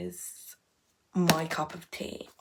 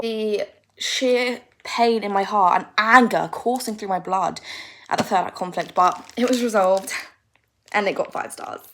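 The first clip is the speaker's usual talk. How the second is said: mock outrage; 9 dB louder than usual. Real, not acted. Ranting about the third act.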